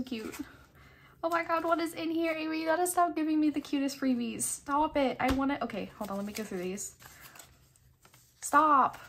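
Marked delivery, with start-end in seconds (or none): 1.2-5.6 high, loud, rushed excitement; excited gushing. Real, not acted. Collector to friend over cutest freebies, excited gushing. 8.4-9.0 high, loud burst of delight; delighted exclamation. Real, not acted. Collector overwhelmed by friend's freebies, delighted exclamation.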